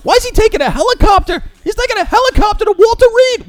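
high, hysterical mocking whine; mock squawking. Host mocking panicked critics, high squawking whine. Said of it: "Everybody's squawking".

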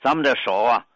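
ordinary talk

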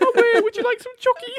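loud, squeaky fake Swiss. Friend piling on the mockery, high mock Swiss. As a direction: mimic a hopeless Swiss accent way up high. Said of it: "pitched up and then just talking".

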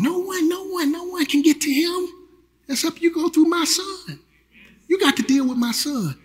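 high-pitched voice of God; a light, high voice of God. Acted. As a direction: speak as God the Father, lifted high. Preacher voicing God's words.

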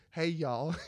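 a put-on van creep voice, offering horror movies through the door; creepy van guy. Host acting a van lurer to his co-host, hammed-up creepy voice. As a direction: lure them in like a shady guy in a van. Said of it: "pulled, like, down a, a door and was like".

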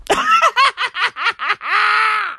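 high, drawn-out cackle; long burst of high-pitched laughter. Real, not acted. Laughing at his own Trump bit.

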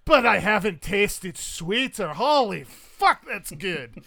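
high, booming Scottish relish; slow, loud and bowled over by the drink; Highland wife. Acted. Wife after crushing a rum can, booming delighted brogue. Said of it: "And she'll say".

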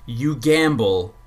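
usual speaking voice

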